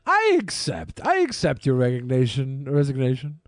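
a hint of a smug dictator accepting; hammed-up tyrant accepting resignation; mock Putin. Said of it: "And then Vladimir Putin".

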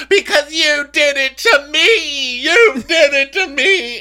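high, loud, drawn-out hysterical wail; mock wailing scream. Castmate accuses her rival on camera, mock wailing scream. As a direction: screech it in hammed-up outrage.

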